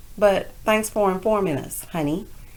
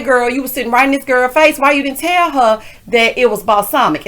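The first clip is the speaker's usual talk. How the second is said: ordinary delivery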